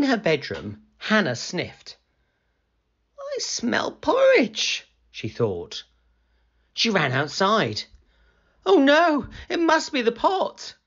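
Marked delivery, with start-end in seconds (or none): 3.2-4.9 put-on slow, very high puzzled girl; high storybook girl voice; Hannah's voice. Hannah sniffing, thinking. 8.7-10.7 put-on high, dismayed girl's voice; loud gasp of dismay, then high worry; alarmed Hannah.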